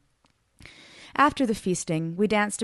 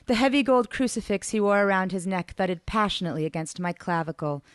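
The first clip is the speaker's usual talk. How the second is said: ordinary delivery, nothing marked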